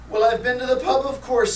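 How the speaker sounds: lightly voiced drunk with a slur; slurring drunk. A narrator's reading. Drunk driver answering the cop who stopped him, slurring. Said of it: "slurs the drunk".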